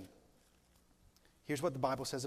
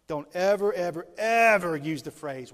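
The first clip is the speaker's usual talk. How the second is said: emphatic warning; 2 semitones higher, 10 dB louder than usual. Acted. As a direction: pound each ever home.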